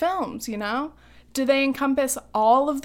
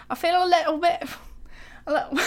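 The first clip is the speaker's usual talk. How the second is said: a clumsy attempt at a posh British accent; bad British accent; 6 semitones higher, much faster than usual. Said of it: "worst British accent I've done".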